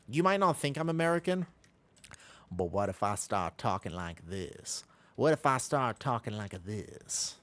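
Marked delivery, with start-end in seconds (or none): 2.5-4.8 slow, lower attempt at a Southern accent; a bad Southern accent. Shown as a demonstration. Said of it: "that was very bad". 5.2-7.4 slow, drawn-out fake Southern accent; second go at a Southern accent; a fake-sounding Southern accent. Shown as a demonstration. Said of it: "that one sounds fake".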